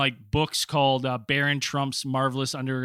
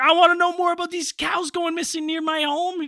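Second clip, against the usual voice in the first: pitched way up, mock earnest plea; mock high voice; 13 semitones higher than usual. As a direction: squeak it out like an eager fan. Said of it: "'Cause I'm like".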